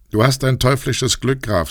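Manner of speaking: mock the count with a smile; scornful jeer at his rival's luck; mocking sneer. A narrator's reading. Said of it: "mit einem höhnischen Lächeln".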